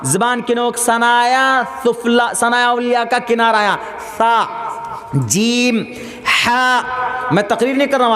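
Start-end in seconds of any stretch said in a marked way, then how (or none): 4.2-6.8 "سا، جیم، ہا" one by one, held long; correct articulation. Shown as a demonstration. Teaching Quran letters, careful drawn-out "سا، جیم، ہا". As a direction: drill "سا، جیم، ہا" as separate Arabic letters. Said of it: "آج قرآن سکھا رہا ہوں آپ لوگوں کو".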